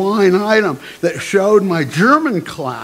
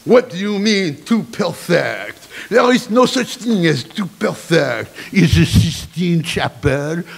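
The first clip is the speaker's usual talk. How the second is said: demanding headmaster. Acted. Headmaster challenges student about transcripts, demanding. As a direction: demand an answer, drawing out the French-accented vowels. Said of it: "The headmaster demanded to know".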